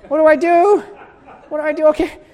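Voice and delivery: a half-laughing spiral into frantic greed; frantic inner tug of war. Retold or imitated. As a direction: argue with yourself until it bursts. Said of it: "I would go back and forth".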